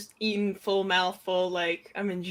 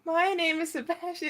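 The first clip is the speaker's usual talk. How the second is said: Dory-like intro; 7 semitones higher than usual. Retold or imitated. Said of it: "It sounded like Dory".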